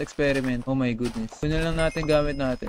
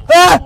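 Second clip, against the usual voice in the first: very high, loud shriek; startled scream; 12 semitones higher, 14 dB louder than usual. Real, not acted. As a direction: shriek at a sudden scare. Monster reappears. (gulat na tili)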